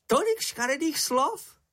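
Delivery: high, squeaky little creature, full of admiration; admiring Sniff. A narrator's reading. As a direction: marvel at it in a high small voice. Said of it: "volal čenich s obdivem". (vysoký hlásek, obdivně)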